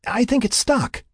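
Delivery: high-pitched yell of trouble. A narrator's reading. Laura reports the stuck rope, yelling. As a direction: call it out far up high. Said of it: "Laura yelled".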